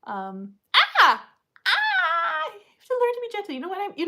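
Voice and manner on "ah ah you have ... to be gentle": high fast yelp then quick scold; high quick scold after puppy bite; surprised ow. Real, not acted. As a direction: squeal at the nip, then scold. Puppy biting hard.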